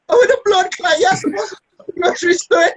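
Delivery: put-on very high Jamaican-accented outburst; mock Jamaican shriek of alarm; Jamaican wife. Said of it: "my wife's Jamaican".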